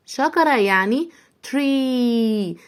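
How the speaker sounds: slow, drawn-out "tree" with a long ee; stretched teacher demo. Shown as a demonstration. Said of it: "بنكسر فيها جامد".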